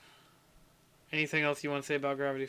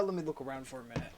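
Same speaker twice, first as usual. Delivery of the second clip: ordinary talk, nothing marked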